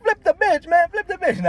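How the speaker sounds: high, loud urgent shout; retold yell. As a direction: yell it across the street. Yelling to dad mid-fight.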